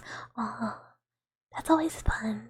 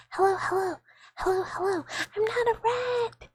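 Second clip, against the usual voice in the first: much higher, squeaky little creature voice; squeaky pet voice; 9 semitones higher than usual. Pretend. Streamer voicing her pet in a much higher squeak. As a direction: squeak it like a tiny offended animal.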